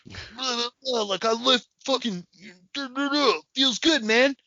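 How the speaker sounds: high, pumped mock bro babble; high mock lifter; mock gym bro. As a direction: ramble like a meathead. Weightlifter bro voice.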